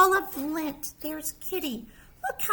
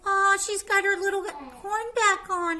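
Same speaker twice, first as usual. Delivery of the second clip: ordinary delivery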